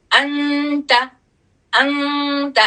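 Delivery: انت with the noon hummed through the nose; nasal noon. Shown as a demonstration. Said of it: "ایسے۔".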